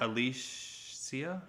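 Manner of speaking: Alicia very slowly sounded out, split before the ending; drawn-out pronunciation attempt. Shown as a demonstration. Co-host puzzling over a viewer's name, slowly sounding out Alicia. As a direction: stretch Alicia out, testing a mix of sh and see-uh. Trying out how name is pronounced.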